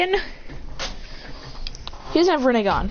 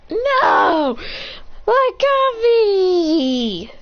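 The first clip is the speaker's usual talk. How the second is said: high, drawn-out mock cry; hammed-up wail; 9 semitones higher, 3 dB louder, much slower than usual. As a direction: wail over a tragic loss, stretching the words. Hero's cup breaks, he wails.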